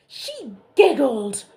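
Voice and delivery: loud, slow whine; hammed-up whimpering king; whining. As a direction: moan it out. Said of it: "went on the king".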